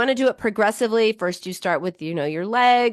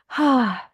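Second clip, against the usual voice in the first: a breathy demo sigh of relief; 5 semitones higher than usual. Shown as a demonstration. A therapist shows listeners a relieved sigh. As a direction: let out a long relieved sigh. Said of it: "sigh of relief".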